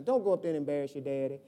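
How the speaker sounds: lightly voiced mama warning her boy; a hint of mama. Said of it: "She used to say that all the time".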